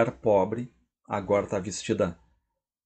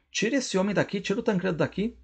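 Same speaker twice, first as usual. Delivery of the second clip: high-pitched demand; irritated order; 9 semitones higher, faster than usual. Acted. Adelaide telling the father, high irritated demand to remove Tancredo. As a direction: snap it up high: get him out.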